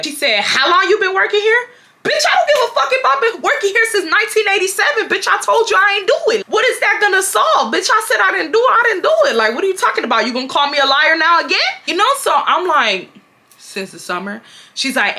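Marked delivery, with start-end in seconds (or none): 0.4-1.7 incredulous manager. Retold or imitated. Acted question from the manager. 2.0-9.4 very high-pitched angry rant; indignant outburst. Retold or imitated. Acted furious comeback to manager. 13.6-14.4 small, soft answer; meek reply. Retold or imitated. Meek answer after the imagined rant.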